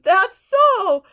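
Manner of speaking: high, loud amazed exclaim; delighted. Acted. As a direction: burst with happy astonishment. Elephant astonished and delighted.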